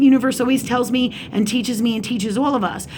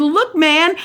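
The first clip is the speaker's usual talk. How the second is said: raised, higher-pitched mock argument; retold quarrel, voice pushed up; exasperated retelling; 7 semitones higher, 4 dB louder than usual. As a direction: act out arguing back, fed up. Retold drive-thru quarrel.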